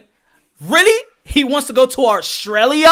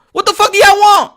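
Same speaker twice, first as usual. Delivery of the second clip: ordinary talk, nothing marked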